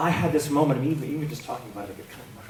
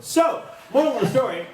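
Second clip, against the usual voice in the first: ordinary talk, nothing marked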